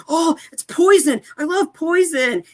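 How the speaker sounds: very high, giddy put-on voice; excited fanboy. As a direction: gush like a starstruck hair-metal fan. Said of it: "He's like".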